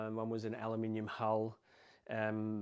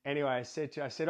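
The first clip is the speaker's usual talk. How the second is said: ordinary talk, nothing marked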